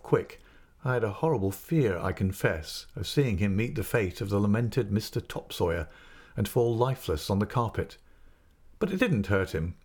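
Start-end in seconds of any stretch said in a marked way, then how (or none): none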